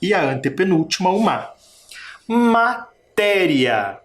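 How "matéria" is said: Matéria very slow, split after MA, long TÉ; stress on TÉ. Shown as a demonstration. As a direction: separate MA and stretch the TÉ in Matéria. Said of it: "Também tem sílaba tônica". (Matéria bem devagar, alongando o TÉ)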